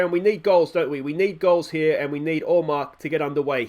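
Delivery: ordinary talk, nothing marked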